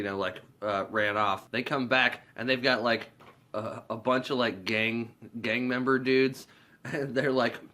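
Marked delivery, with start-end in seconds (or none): none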